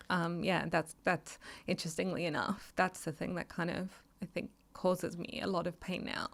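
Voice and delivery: ordinary delivery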